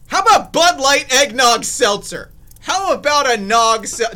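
loud, high product yell; hype shout. Acted. Vlogger shouting the seltzer name at viewers, loud hype.

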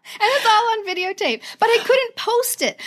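high-pitched, choked-up telling; tearful, emotional. Real, not acted. Author explains the unposted video, tearfully emotional. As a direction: push through happy tears. Still emotional after crying story.

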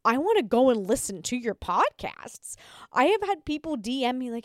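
ordinary talk

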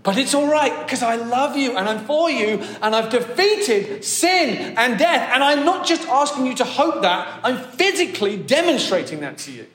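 high, loud and eager comforting; Jesus reassuring a terrified John, high and loud; excited reassurance. Retold or imitated. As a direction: reassure a frightened friend with huge enthusiasm. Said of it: "Jesus is like".

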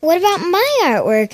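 high rising plea for attention; mock whiny question. Retelling Riley asking about her artwork, high whiny plea. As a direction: whine it up high. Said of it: "Riley's like".

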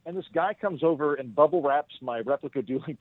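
ordinary delivery

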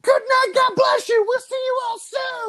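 high, loud arena-frontman shout. Acted. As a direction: hype the crowd like a rock star on stage. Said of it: "that was the voice of [name]".

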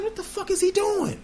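high squeaky incredulous question; retold disbelief. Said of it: "I was like".

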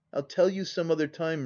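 plain reading voice

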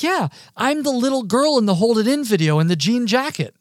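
high, loud excited fan voice; acted-out giddy revelation; retold freak-out. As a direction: burst out with it, imitating a thrilled fan. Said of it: "absolutely freaks out".

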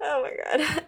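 high squealing laugh into oh my God; real giggly shock; shocked laughter. Real, not acted. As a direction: laugh out the disbelief, pitch way up. Laughing at the passage.

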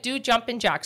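normal talking voice

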